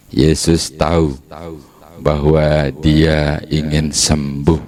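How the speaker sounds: normal talking voice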